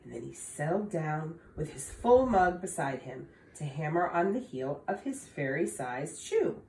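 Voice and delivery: ordinary delivery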